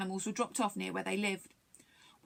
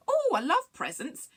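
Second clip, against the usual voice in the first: high, loud delight; excited alien; 8 semitones higher, 5 dB louder than usual. A narrator's reading. Aliens react to being offered a present, excited. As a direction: exclaim it high and thrilled. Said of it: "became a bit excited".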